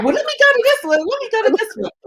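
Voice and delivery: high, laughing mock voice of her friend; teasing mimic. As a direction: tease her by parroting her in a squeaky voice. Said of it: "You're like".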